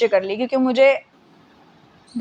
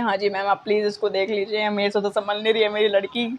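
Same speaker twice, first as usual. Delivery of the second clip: mock tearful mom; 7 semitones lower, 3 dB quieter than usual. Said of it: "mummy रोते-रोते कहती है".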